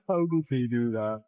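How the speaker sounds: ordinary talk, nothing marked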